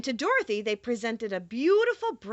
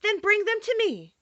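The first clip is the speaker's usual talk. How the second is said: lightly voiced girl, pitched well up; a touch of a girl giving orders; Dorothy lifted higher in a firm request; Dorothy's voice; 10 semitones higher than usual. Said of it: "she said".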